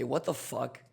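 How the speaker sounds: ordinary delivery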